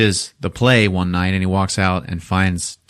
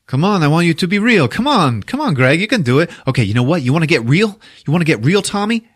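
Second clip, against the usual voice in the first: high, insistent pestering; mock goading; 9 semitones higher, 3 dB louder, faster than usual. Tommy pushes Greg to be real, acted high-pitched goading. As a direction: act out pushy egging-on turning into a challenge.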